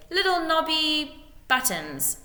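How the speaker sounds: slow, high, drawn out; improvised name. A narrator's reading. Lord inventing a messenger's name on the spot, slowly. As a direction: invent it as you say it.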